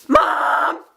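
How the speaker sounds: retold giddy cry down the phone; freaking out. Fan phoning mom after the picture, freaking out. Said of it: "I'm, like, freaking".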